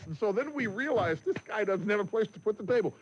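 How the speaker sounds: ordinary delivery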